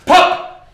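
loud breathy pop of a gun; loud unvoiced gun pop; unvoiced mock gunshot. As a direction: blurt a gunshot noise with no voice. Imagined gunshot.

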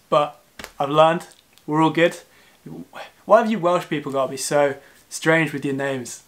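ordinary delivery

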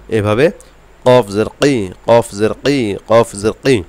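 slow, even drill of each letter with its kasra; Arabic letter drill. Shown as a demonstration. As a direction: recite each letter like a Quran teacher. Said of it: "এভাবে আপনারা একটু বেশি বেশি করে পড়ে". (আরবি হরফ মশকের উচ্চারণ)

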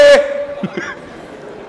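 long breathy laugh; real amused laughing; laughter. Real, not acted. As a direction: laugh after the shouted bit. Laughs after acting the clerk.

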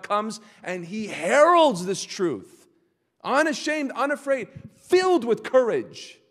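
loud, high, proclaiming; bold heralding. Acted. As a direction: preach it with full courage. Preacher heralding the prophet's boldness.

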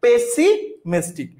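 slow. Shown as a demonstration. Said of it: "correctly spelled word কোনটা হবে".

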